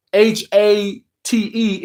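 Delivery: H A T E spelled letter by letter, slow. Shown as a demonstration. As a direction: spell out H A T E slowly, each letter apart. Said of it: "forgive me in advance if the word".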